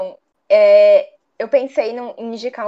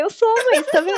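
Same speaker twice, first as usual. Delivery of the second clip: laughing reply; 10 semitones higher than usual. Retold or imitated. Daughter answers mom, laughing.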